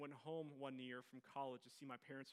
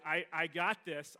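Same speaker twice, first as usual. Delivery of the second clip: loud, slow insistence; insisting; 3 semitones higher, 14 dB louder, slower than usual. Retold or imitated. Telling the old lady he'll take over, loud slow insistence. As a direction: plant your feet and insist.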